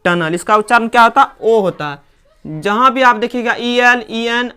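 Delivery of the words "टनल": टनल as "TUN-ul"; correct pronunciation. Shown as a demonstration. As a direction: say टनल with schwa before l. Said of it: "क्या पढ़ा जाएगा".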